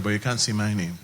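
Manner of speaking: ordinary delivery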